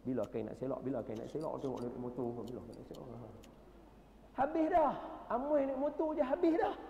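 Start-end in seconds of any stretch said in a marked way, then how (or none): 4.4-6.8 much higher and louder punchline; comic punchline. Real, not acted. Preacher joking that faith is gone at one glance. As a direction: land the joke with mock despair.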